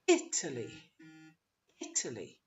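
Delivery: "Italy" stressed on "IT", the rest weak; first-syllable stress. Shown as a demonstration. Teacher modelling the English word, first syllable stressed. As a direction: hit "It", trail lightly through "aly". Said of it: "The first syllable would be stressed".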